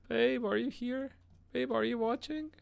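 very high-pitched pleading call; squeaky little summons to a partner; calling sweetly. Real, not acted. Calling out to absent girlfriend.

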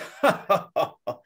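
short, jeering ha-ha-ha; mocking laugh. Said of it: "sort of mocking laugh".

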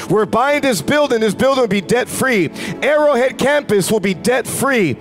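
normal talking voice

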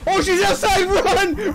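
high, loud laughing scream; frantic scream to run; panicked shouting. Real, not acted. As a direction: yell the warning while cracking up. Monster chases players.

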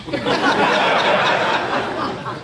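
high-pitched breathy cackle; high laugh. Real, not acted. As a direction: burst into a high wheezing laugh. Comedian cracks up at story.

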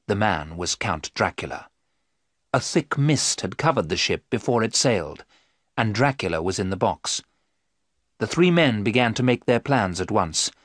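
plain reading voice

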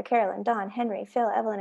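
ordinary delivery

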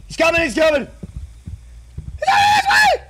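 high, loud cries rising to a shriek; hammed-up escalating panic; gamer panic. Panicking as chaser approaches.

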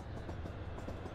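sharp thrilled intake of breath; a sudden breathless gasp of joy; excited gasp. Real, not acted. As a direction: gasp in delight at the train. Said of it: "Sorry, I got a little excited".

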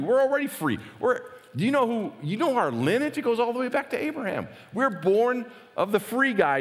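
high, sneering imitation of smug leaders; mocking Pharisees. Religious leaders boast to Jesus of their lineage, in a high mocking whine. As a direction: mimic their proud whining. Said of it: "And they answered him".

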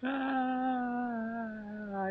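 ordinary talk

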